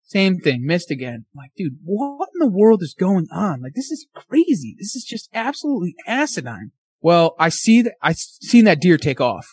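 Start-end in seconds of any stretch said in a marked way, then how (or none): 1.6-6.7 high-pitched, incredulous mock outburst; exasperated disbelief. Hunter reacting to his buddy missing again, incredulous. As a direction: replay utter disbelief, pitch way up. Acted reaction to repeated misses.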